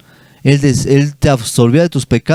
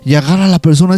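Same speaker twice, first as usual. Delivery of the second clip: ordinary delivery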